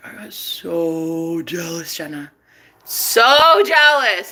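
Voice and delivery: drawn-out mock jealous boyfriend. Woman quoting her boyfriend's confession, mock jealous. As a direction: stretch the key words, imitating his jealousy.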